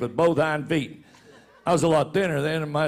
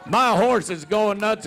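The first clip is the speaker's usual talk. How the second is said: normal talking voice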